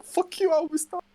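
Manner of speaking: teasing his friend with a mock high whine; mocking squeaky voice. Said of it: "It was like".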